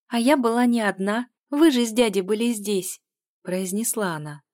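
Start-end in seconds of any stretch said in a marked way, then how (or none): 0.1-3.0 put-on higher, louder little-girl voice; childlike, insistent objection; protesting child. A narrator's reading. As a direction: defend yourself innocently like a child.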